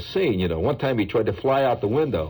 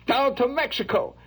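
ordinary delivery

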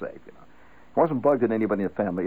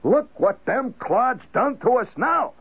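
slow, much higher griping at nobody in particular; abstract bugged grumble; 13 semitones higher, 3 dB louder, slower than usual. Shown as a demonstration. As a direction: gripe at the newspaper, not at anyone. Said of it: "that is the abstract bug".

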